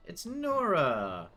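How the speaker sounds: slow, loud happy exclaim; delighted greeting. Real, not acted. Greeting a game character.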